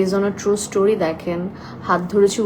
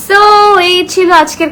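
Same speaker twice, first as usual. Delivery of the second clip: excited wrap-up; 9 semitones higher, 9 dB louder, slower than usual. Real, not acted. Loud wrap-up.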